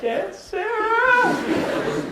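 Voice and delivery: very high, long drawn-out squeal; mocking tease. Brother teasing sister she resembles aunt, drawn-out squealed mockery. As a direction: stretch the name into a high taunt.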